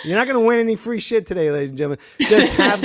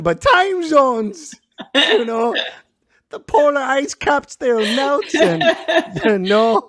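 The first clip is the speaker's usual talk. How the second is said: teasing imitation with long stretched vowels; mimicking tipsy guest; 5 semitones higher, slower than usual. Host mocking drunk Cheryl, tipsy imitation. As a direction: drag out the words in a mock Minnesotan slur.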